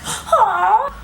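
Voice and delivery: loud, high re-enacted yell; short mock attack cry after a gasp; battle yelp. Jumping into bedroom carrying candle, high mock yell.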